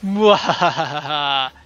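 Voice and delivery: slow hammed-up evil laugh; mad scientist cackle.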